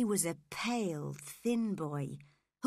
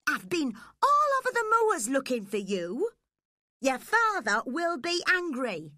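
mock scolding, louder and higher; pretend anger; 5 semitones higher, 6 dB louder than usual. Nurse scolding the runaway girl, mock angry. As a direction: scold her with put-on anger. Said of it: "I pretended to be angry to punish her".

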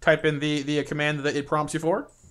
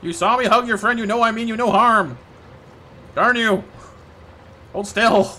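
raised, louder protest; worked-up, high-pitched complaint; exasperated scolding; 5 semitones higher, 5 dB louder than usual. Pretend. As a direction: plead loudly with a runaway pet. Scolding the elusive gnome.

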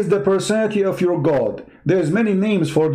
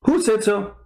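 mocking echo; 6 semitones higher than usual. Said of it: "Did they say".

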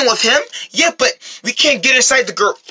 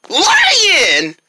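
high, drawn-out yell on spotting it; 6 semitones higher than usual. Acted. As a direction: yell it out to alert everyone.

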